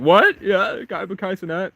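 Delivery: high-pitched, incredulous outburst; acted excited surprise. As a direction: burst out high, as if astonished. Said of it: "he's like".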